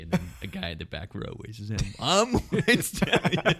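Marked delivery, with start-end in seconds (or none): 2.0-3.5 high, loud, long-drawn call; retold shouted answer. Rival answering from the back row, imitated high drawn-out shout. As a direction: holler it slowly from the back row.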